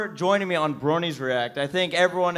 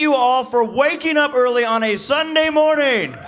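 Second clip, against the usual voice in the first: calling out; 9 semitones higher, 5 dB louder than usual. Real, not acted. Host addressing whole crowd.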